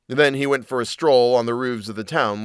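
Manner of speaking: ordinary delivery, nothing marked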